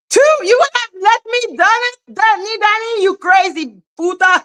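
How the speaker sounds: high squeaky mock scold. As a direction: play a bratty friend scolding her.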